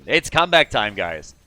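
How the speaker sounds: play-by-play hype; excited rally call. Real, not acted. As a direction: announce the comeback with punch. Rallying call in live match commentary.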